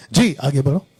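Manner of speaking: normal talking voice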